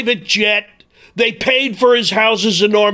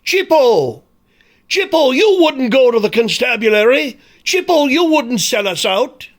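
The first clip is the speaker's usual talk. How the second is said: mock Irish pleading; 6 semitones higher than usual. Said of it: "Listen to [name]'s song".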